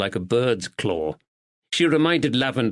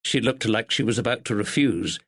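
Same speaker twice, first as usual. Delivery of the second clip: plain reading voice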